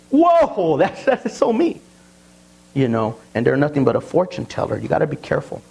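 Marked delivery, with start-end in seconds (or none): 0.1-1.8 much higher, slow mock amazement; mock gullible listener. Pastor mocks a listener impressed by a false prophet, high. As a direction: gush like someone fooled by a fortune teller. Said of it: "and say".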